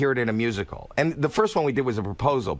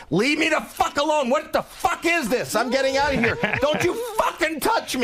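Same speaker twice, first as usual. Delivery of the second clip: screaming in terror at a sudden dance troupe; panicked yelling. Retold or imitated. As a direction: yell like a man who thinks a gang is after him. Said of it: "and he starts going".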